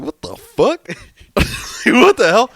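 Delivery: high, loud, laughing disbelief; retold shock. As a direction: burst out in amazed disbelief. Finding the hamster gone.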